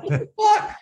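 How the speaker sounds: very high, squeaky mock shock; stunned disbelief. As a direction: gasp it out, dumbfounded. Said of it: "And I'm just standing like".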